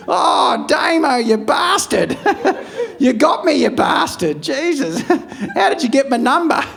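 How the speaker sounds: good-natured mock laughter at the gotcha; laughing relief. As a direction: crack up at being pranked. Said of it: "[name] laughing at prank".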